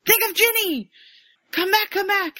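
high, loud, urgent pleading; Dudley pleading over collapsed Harry; pleading shout. Retold or imitated. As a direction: beg him to wake up. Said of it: "he's like".

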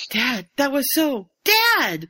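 rising high to a loud cry of Dad; a kid's excited shout building up; child calling after her father. Retold or imitated.

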